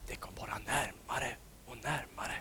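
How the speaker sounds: hushed whisper of something coming; creeping whisper. A narrator's reading. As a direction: whisper it as the danger nears. Light approaching, suspense.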